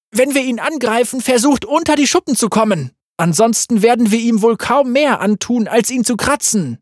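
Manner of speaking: high tense commands; brief the fighters fast and tense; urgent orders. A narrator's reading. Corvus instructs soldiers against the dragon, high and urgent. Battle instructions before attack.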